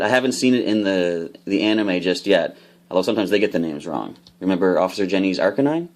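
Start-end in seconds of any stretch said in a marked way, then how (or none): none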